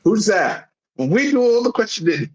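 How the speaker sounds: high, stiff German interrogator voice; mock German interrogator. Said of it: "German words".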